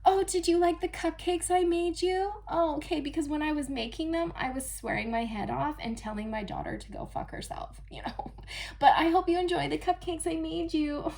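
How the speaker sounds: Little Miss Perfect. Pretend. The fake mother offering cupcakes, sugary and high. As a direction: smile through the lie. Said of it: "presented like Little Miss Perfect".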